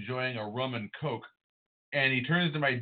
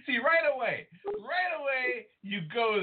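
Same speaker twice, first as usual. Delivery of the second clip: high-pitched, laughing through words; 12 semitones higher than usual. Real, not acted. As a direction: talk while still laughing. Laughing while teasing.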